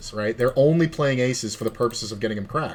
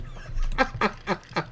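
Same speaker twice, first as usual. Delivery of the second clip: breathy laugh; 9 semitones higher, 5 dB quieter than usual. Real, not acted. Laughing after the story.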